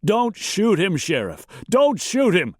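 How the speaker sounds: high, desperate plea; panicked, high-pitched begging; terrified scream. A narrator's reading. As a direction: scream for your life to the sheriff. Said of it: "and screamed".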